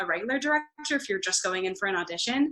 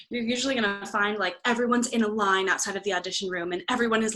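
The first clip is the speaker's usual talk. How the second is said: normal talking voice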